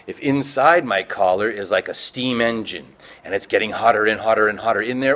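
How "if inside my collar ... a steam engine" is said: ordinary delivery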